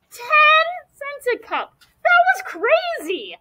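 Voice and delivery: can't believe the price, voice leaps up; shocked disbelief. Acted.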